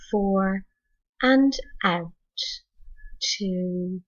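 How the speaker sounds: usual speaking voice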